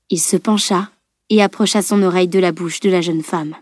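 usual speaking voice

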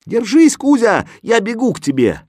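high, loud encouraging shout; shout to the cat. A narrator's reading. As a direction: call out to rescue him.

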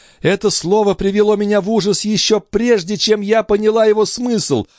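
high, anguished accusation; distressed, high-pitched outburst; moaning in horror. A narrator's reading. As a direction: recoil from the word in horror. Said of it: "простонала Андреа".

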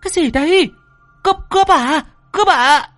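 very high-pitched shrieked questions; startled scream. Acted. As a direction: shriek as if suddenly grabbed by a robber. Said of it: "thì hét lên".

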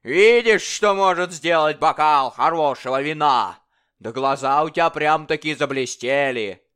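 loud, high, mocking tyrant king; bully the dwarf like a drunken king; boisterous royal bully demanding fun. King voiced in story.

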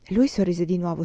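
usual speaking voice

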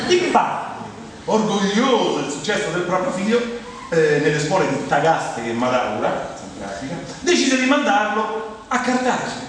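high-pitched, formal proclamation of a life story; mock-solemn biographical recital; biography reading. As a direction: read it out grandly, like an official biography. Resumes the biography after an aside.

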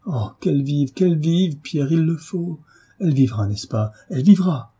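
high, impassioned plea; desperate pleading. A narrator's reading. Desperate plea for her life. (supplication fervente et aiguë)

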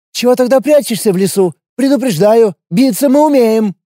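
wary fighter, blunt and guarded; young warrior woman. A narrator's reading. Lina's threatening warning.

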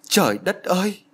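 high-pitched despairing exclamation; narrator's restrained wail; sobbing. A narrator's reading. Husband protesting he was forced, high mild wail. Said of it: "Ông Tư ôm mặt khóc nức nở".

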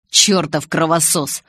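slow grudging curse; a touch of disgusted muttering; resentful mutter. A narrator's reading. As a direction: grumble the insult slowly. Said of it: "наконец пробормотал он".

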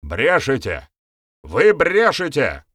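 rising, drawn-out shout of accusation; nervous outcry. A narrator's reading. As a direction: deny it loudly, cornered and nervous. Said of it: "выкрикнул Гостята, нервно заозиравшись".

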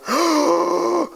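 sharp high startled gasp; mock gasp. Preacher acting out Satan's fright, mock gasp.

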